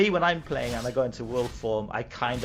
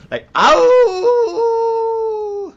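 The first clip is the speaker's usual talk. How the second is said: high, loud wolf howl; werewolf howl; 11 dB louder than usual. Shown as a demonstration. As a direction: throw your head back and howl like a wolf. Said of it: "Can you do a werewolf howl?".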